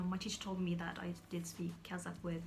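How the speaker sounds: ordinary talk, nothing marked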